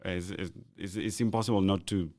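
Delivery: usual speaking voice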